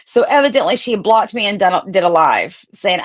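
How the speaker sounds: usual speaking voice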